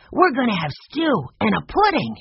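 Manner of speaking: put-on high, eager boy voice; young Benny. A narrator's reading. Benny teasing Peter about supper, high and eager. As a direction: lift into a keen little boy's voice.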